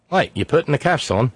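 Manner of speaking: hammed-up Australian surprise; mock Australian arrestee. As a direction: question the officer in a put-on Australian accent. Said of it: "a woman in Oz being arrested".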